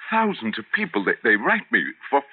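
desperate plea. Acted. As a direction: beg him not to end your column. Pleading with boss who fires him.

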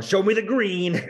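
high, put-on tough dealer voice; a hammed-up street dealer; cheesy dealer. Friend mocking the movie dealer's cheesy line.

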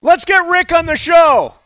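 loud, high excited call; excited shout. Real, not acted. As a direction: yell it out gleefully. Calling for Rick on air.

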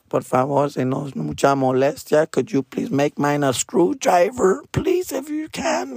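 meek drinker asking for a screwdriver instead of a shot; overly polite plea. Retold or imitated. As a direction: beg the bartender so nicely it squeaks. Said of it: "'cause I would be like".

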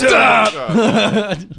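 high, loud up top cheer; excited call. Real, not acted. Repeats high five call.

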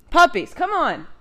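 loud, high call to the puppies; calling the dogs. Real, not acted. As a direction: call out to the dogs across the room. Calling dogs mid-recording.

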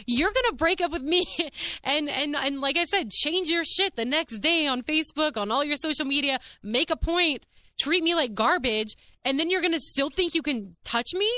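high, heated outrage at him; incredulous high-pitched venting; indignant rant. Retold or imitated. Venting at ex's disrespect.

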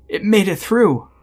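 high, loud frightened warning; alarmed kid. A narrator's reading. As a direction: cry it out high, as a scared child. Waking kid speaks in terror.

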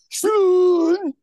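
high, drawn-out "Sean" with a long oo; Irish regional pronunciation. Shown as a demonstration. Host showing how Irish regions say his name, drawn-out "Sean". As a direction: stretch "Sean" into a high "Shoon". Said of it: "depending on where they're from in Ireland".

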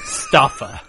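laughing Scottish sign-off; Scottish accent. Acted. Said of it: "read it in a Scottish accent".

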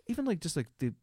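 usual speaking voice

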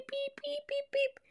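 high bleeping beep noise; censor bleep. Retold or imitated. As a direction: mimic a TV censor beep over the curse. Self-censoring a curse word. (imitación de pitido de censura, agudo)

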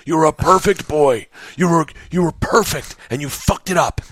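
high, anguished self-reproach; inner panic. Acted.

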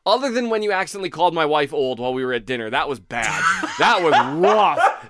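ordinary talk

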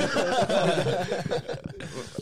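long loud laugh, pitch rising; real laughter. Real, not acted. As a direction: keep laughing. Continues laughing.

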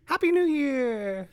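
bright, drawn-out holiday cheer; cheerful greeting. Real, not acted. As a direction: sing out the new year wish. Greeting the new year.

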